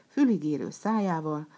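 ordinary delivery

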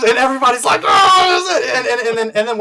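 high, loud laughing telling; cracking up while telling the story; excited laughing retelling. Real, not acted. Retelling the theater moment, laughing.

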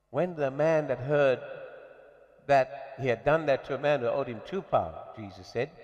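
ordinary delivery

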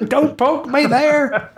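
high, slow, squeaky imitation; squeaky mock plea. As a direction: whine it high and slow like a joke. Imitates caller's prank line.

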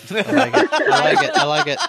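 high, loud, laughing approval; excited. Real, not acted. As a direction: squeal it out with a laugh. Excited reaction, repeated.

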